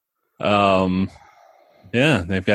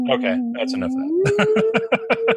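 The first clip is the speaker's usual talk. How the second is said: laughing; slower than usual. Real, not acted. Breaking out of the bit.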